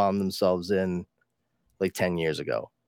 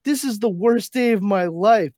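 high, louder mock whine; a hammed-up cartoon kid; whiny Bart quote; 9 semitones higher, 5 dB louder than usual. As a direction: wail it like a kid who has had a bad day.

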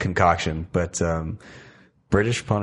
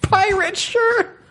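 slow, squeaky disbelief, much higher; incredulous echo; much slower than usual. Real, not acted. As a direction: stretch it out in amazed disbelief.

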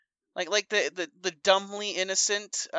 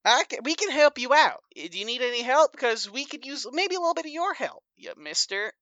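Papa Scuff, folksy negotiator; 7 semitones higher than usual. Acted.

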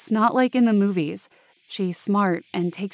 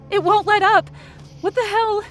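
high, alarmed cry; frantic disbelief, much higher voice; panicked outburst; 10 semitones higher than usual. Acted. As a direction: exclaim it mid-attack, pitch shooting up. Narrator under relentless attack.

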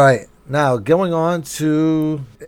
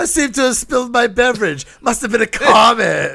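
mock stuffy gentleman; 9 semitones higher, 3 dB louder than usual. Mock posh bit continuing.

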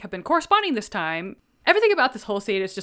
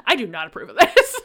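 louder mock stern line breaking into a laugh; laughing disapproval; 2 semitones higher, 7 dB louder than usual. Knightley disapproving of Frank's game, laughing through it. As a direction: play stern but crack up.

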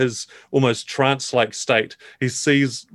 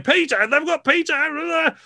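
much higher, slow, alarmed cry; alarmed brother; slower than usual. Acted. Brother discovers Peter is taken, high alarmed cry. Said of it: "someone's saying".

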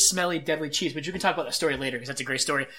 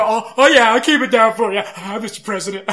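loud, teasing sarcastic reply; mocking sarcastic; 5 semitones higher, 10 dB louder than usual. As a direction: sneer it back in mock obedience. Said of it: "And I go".

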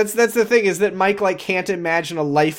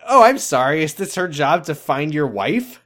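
higher, louder sarcastic retort; sarcastic mock apology; 4 semitones higher, 5 dB louder than usual. Sarcastic comeback to retold demand.

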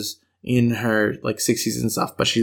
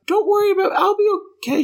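sweet grandma; much faster than usual. Retold or imitated. Injured grandma calming grandchild, in a high sweet voice. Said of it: "She just was like".

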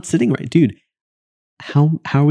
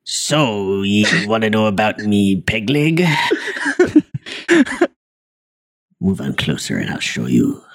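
put-on gruff old sailor, slow; old sea captain; slower than usual. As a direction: play a salty old sea dog. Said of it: "an old sea captain".

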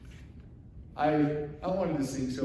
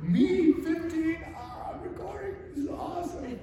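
much higher, giddy excitement; mock thrilled young voice; giddy teenager. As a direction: squeal like a fifteen-year-old recording himself. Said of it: "I'm, like".

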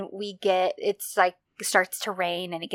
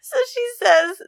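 laughing through words; 4 dB louder than usual. Real, not acted. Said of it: "I can't talk".

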